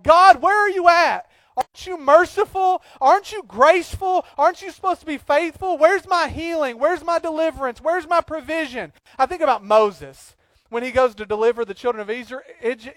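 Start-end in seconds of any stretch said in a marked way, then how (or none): 0.0-8.9 mock pleading complaint. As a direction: demand answers from God. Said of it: "we're like".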